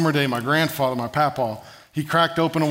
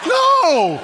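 high, loud panicked yelp; mock-horrified outburst, pitched way up; freaking out; 5 dB louder than usual. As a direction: cry out in hammed-up childish alarm. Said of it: "I'm freaking out".

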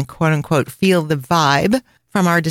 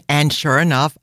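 ordinary delivery

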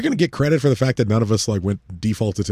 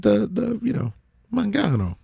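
put-on Italian accent; 8 semitones higher, slower than usual. Imitating Italian finger gesture.

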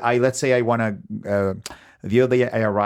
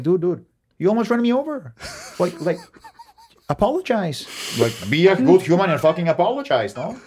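retold confrontation; 6 semitones higher than usual. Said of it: "And I'm like".

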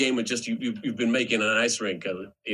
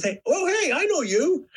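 Trudeau greeting; 3 dB louder than usual. Retold or imitated. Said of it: "and he goes".

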